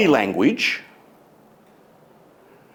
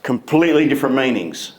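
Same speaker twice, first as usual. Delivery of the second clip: usual speaking voice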